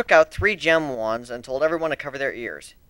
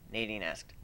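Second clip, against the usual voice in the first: ordinary delivery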